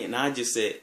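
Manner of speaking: ordinary talk, nothing marked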